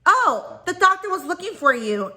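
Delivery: high, sing-song fake excuse, imitated; mimicked receptionist. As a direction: mimic the receptionist covering for the doctor. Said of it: "she was like".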